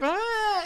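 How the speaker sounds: very high-pitched fake scream; hammed-up high shriek; mock terrified squeal. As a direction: imitate a kid recoiling in terror. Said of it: "recoils backwards, terrified".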